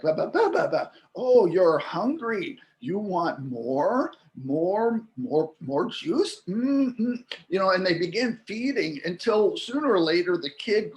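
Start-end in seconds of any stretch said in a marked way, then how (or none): 0.0-0.9 put-on baby babble. Shown as a demonstration. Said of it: "So this is like". 1.1-4.1 slow, sing-song parent voice; parent to baby. Retold or imitated. Parent answering a babbling baby, slow and sing-song. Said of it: "So this is like". 4.4-7.2 put-on slow rising baby-talk questions; baby talk to child. Parent offering juice to a toddler, slow rising baby talk. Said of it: "So this is like".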